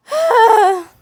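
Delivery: loud high sigh with a laugh. Real, not acted. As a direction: let out a big laughing sigh. Exasperated after failed intro.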